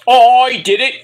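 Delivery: high, loud mock boast; mock boastful call. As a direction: belt it out, imitating a boastful wrestler. Mimics wrestler coming out.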